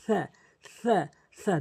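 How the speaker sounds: সা with the tongue tip touching the front teeth; সা repeated for copying; Arabic tha. Shown as a demonstration. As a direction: model সা as a th sound. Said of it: "জিহ্বা বের করে দিয়ে".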